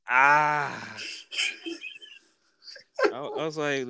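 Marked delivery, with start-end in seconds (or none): 0.1-1.0 long, raised "ah" of collapse; passing-out groan. Retold or imitated. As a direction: imitate someone flopping down with a drawn-out "ah". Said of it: "passed out on the grass and went".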